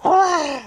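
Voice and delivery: high, retold yelp of pain; imitated cry. Boy hit by BB, imitated high yell. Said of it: "all I heard was".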